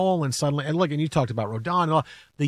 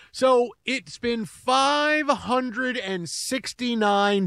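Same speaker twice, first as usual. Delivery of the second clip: dramatic announcer; 7 semitones higher, 4 dB quieter, much slower than usual. Pretend. Dramatic countdown intro.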